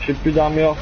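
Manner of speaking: normal talking voice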